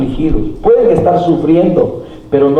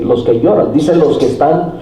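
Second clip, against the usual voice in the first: ordinary delivery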